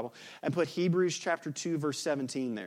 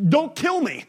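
much higher and louder, a panicked cry; terrified plea; 13 semitones higher, 12 dB louder than usual. Acted. Frightened believer facing an angel, panicked high cry. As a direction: beg for your life in sudden terror.

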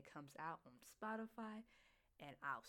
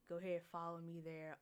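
ordinary delivery

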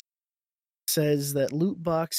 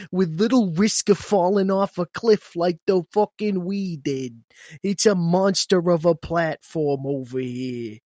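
Italian-American mobster accent; 4 dB louder than usual. Shown as a demonstration. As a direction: quote redone as a Sopranos-style Italian-American, a bit louder. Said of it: "you had me read it like that?".

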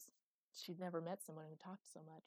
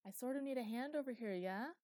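put-on raised, louder boyish plea; calling for help; 6 semitones higher, 7 dB louder than usual. A narrator's reading. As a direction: call over cheerfully for a hand.

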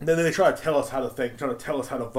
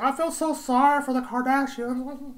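high, slow fake crying whine; mock weepy sympathy; 11 semitones higher, slower than usual. Mocking fans pitying the Kardashians, high fake-weepy voice. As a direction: wail it in sarcastic, overdone pity. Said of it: "they're all crying. Everybody's like".

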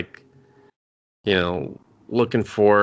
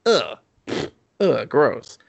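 higher, exaggerated gagging disgust; mock revulsion, pitched up; 5 semitones higher, slower than usual. Viewer seeing an old lady witch finger, hammed-up disgust.

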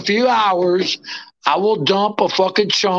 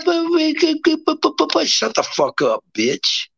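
snarling retort; 8 semitones higher, much slower than usual. Acted. Retort to rival.

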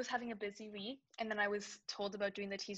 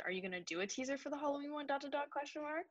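higher, pointed mock demand; 6 semitones higher than usual. [name] acting out how Caitlin's text read to her, higher. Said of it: "this is how I read it".

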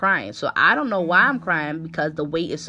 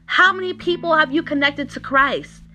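emphatic preaching with raised pitch; fired-up sermon delivery; exhorting preacher; 6 semitones higher than usual. Real, not acted. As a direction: challenge the congregation with pointed, ringing questions. Preaches, challenging the congregation.

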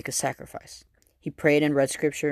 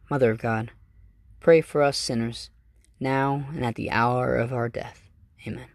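prayer recitation; 3 semitones lower than usual. Acted. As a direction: pray it aloud, reverently. Recites the Hail Mary.

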